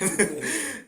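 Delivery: quick bright laugh; short laugh. Real, not acted. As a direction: burst out laughing. Laughing at people not studying.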